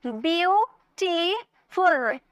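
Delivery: "ब्यूटीफुल" very slow, ब्यू, टी, फुल; syllable by syllable. Shown as a demonstration. Teacher repeating for students, ब्यूटीफुल in three slow pieces. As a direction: split ब्यूटीफुल into three long separate syllables. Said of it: "तीन syllable".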